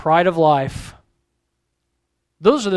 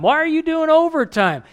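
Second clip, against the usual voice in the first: higher, pointed question; challenging question; 8 semitones higher than usual. Retold or imitated. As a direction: poke at the excuse, pitch up. Pastor retorts to excuse.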